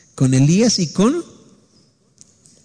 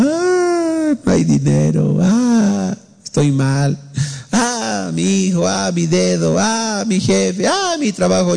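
hammed-up whining wails, each Ah pitched up high; mock weeping complaint; 5 semitones higher, slower than usual. Preacher mocking a faithless believer who cries over money, with hammed-up wailing. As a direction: imitate a faithless moaner crying over his troubles. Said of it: "llorando, llorando".

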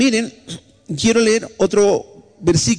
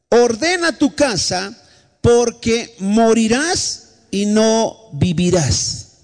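slow solemn prophecy; 2 semitones higher, slower than usual. Acted. Prophet's words to dying king.

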